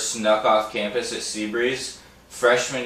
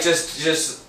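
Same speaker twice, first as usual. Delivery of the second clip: mocking friend; 6 semitones higher, 6 dB louder than usual. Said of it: "Normally, you're like".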